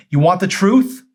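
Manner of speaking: very high, loud challenge; hollering. Acted. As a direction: holler it at her. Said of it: "Joel hollered".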